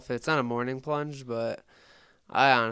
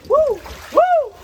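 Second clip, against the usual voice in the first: very loud, high whooping yells; exhilarated whoop; 12 dB louder, much slower than usual. Real, not acted. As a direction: whoop with cold-water adrenaline. Climbing out of the ice bath.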